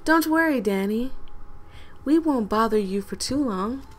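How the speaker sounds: usual speaking voice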